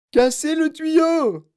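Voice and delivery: très aigu, fort et lent; hurlé. Acted. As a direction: hurler un ordre. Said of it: "hurla madame Gloop". (Mme Gloop hurle en brandissant son parapluie)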